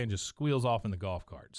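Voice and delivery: ordinary delivery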